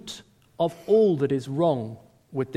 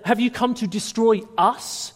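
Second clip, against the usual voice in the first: mock cry of the possessed man; 6 semitones higher, 5 dB louder than usual. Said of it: "the man cries out".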